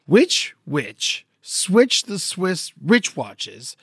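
very slow, every word separate; slow, careful tongue twister. Shown as a demonstration. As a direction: pick each word out carefully. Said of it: "tongue twister".

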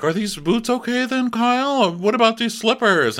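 high-pitched, pleading housewife voice; mock high-voiced reality star; acted-out housewife. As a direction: ask in a high put-on voice. Acting out TV reality-show quarrel.

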